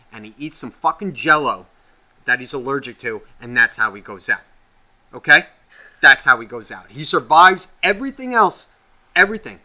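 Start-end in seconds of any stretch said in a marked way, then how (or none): 5.1-9.6 loud ranting yell. Real, not acted. Host yells about the software guy's fate. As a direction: shout it out in a rage. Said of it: "I'm just fucking yelling".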